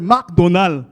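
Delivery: loud, mock Arabic-accented McDonald. Shown as a demonstration. Comic shows how Dubai says McDonald's, loud Arabic accent. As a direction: hit both halves hard with an Arabic accent. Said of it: "over there".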